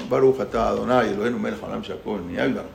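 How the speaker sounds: low, even prayer murmur; reciting a blessing. Acted. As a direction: say the blessing calmly, pitched low. Bendición antes de tomar agua. (bendición recitada en voz grave)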